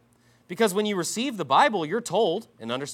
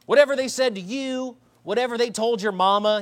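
higher, mocking Southern accent; annoying Southern accent; 6 semitones higher than usual. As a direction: imitate a preacher's grating Southern accent. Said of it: "he's one of these guys with a really annoying Southern accent".

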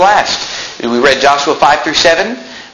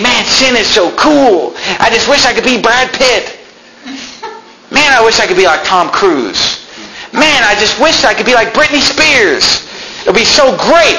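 lightly mimicked starry-eyed wishing, higher; mock star-struck admirer; 8 semitones higher than usual. Preacher mimicking someone wishing to be a celebrity, mock-gushing. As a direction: gush mockingly with envy.

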